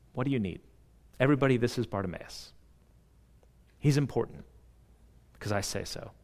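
soft, low, tender voice; quiet reassurance from the pulpit; tender hush. Real, not acted. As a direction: drop low and soft, lovingly. Tender hushed sermon passage.

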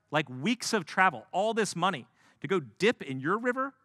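high, loud insulted tirade; furious nobleman ranting; offended rant. Acted. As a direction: rant like a slighted VIP. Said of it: "He is deeply offended".

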